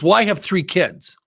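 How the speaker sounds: irritated pushback, imitated; retold anger. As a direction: act out an angry man's defensive comeback. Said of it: "He's still angry".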